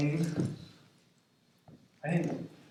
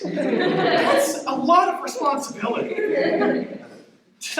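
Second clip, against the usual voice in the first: higher, louder, slow and laughing; laughing while talking; 13 semitones higher, 7 dB louder, much slower than usual. Real, not acted. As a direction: laugh in awe at all she carried. Laughing in awe at grandma.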